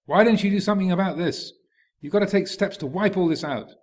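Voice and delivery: high-pitched insistent reproach; urgent scolding. Acted. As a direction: push the bodhisattva to wipe it all out. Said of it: "The ascetic figure up here is bashing them down".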